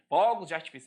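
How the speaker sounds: "Fogos" with its first vowel opened up. Shown as a demonstration. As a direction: open o in "Fogos". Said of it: "Você não fala fogos de artifício".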